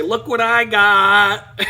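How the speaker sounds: smug gloat. Retold or imitated. Gloating at friend with receipt.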